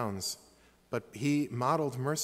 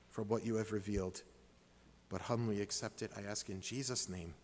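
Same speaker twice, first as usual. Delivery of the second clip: earnest closing prayer. Real, not acted.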